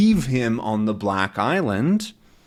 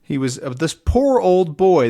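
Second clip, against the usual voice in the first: ordinary delivery, nothing marked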